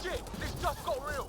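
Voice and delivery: loud, high combat yell; shouting. Acted. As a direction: yell it over gunfire. Firefight breaks out.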